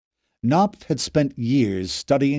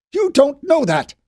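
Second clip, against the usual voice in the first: desperate protest; 13 semitones higher, 5 dB louder than usual. A narrator's reading. Knopf protesting.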